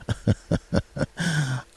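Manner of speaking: soft mock-evil laugh; slow, gloating staged chuckle; villainous chuckle. Boyfriend taunting her before hanging up, villainous laugh. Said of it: "What she must have heard was".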